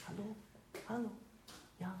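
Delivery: small, soft mock "Hallo, hallo, ja"; timid calling. Shown as a demonstration. Preacher mocks a feeble call to his congregation, soft and timid. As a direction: call out meekly, barely trying. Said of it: "so ruft man nicht".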